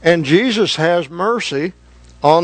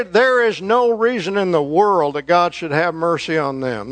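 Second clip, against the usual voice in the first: ordinary delivery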